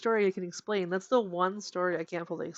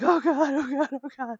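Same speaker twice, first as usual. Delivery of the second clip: high, urgent repeated panicking, louder at first; retold panic; 8 semitones higher, 5 dB louder than usual. Friend retells cuddling the dog in terror, mock-panicked. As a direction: act out frightened babbling, pitched well up. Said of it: "we cuddled the dog and we were like".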